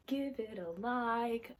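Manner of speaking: slow, stretched words in a put-on voice; playfully drawn out. Vlogger asks viewers for likes, playfully drawn out. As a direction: draw each word out playfully.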